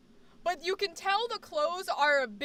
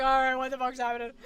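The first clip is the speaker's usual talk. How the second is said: quick, casual, out of character; snap back to normal; 2 semitones lower, faster than usual. Acted. Actor stepping back from the line, blunt and quick. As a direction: rush it, dropping all sweetness. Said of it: "I love the transition".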